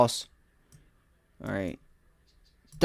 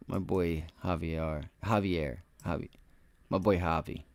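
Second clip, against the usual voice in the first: ordinary delivery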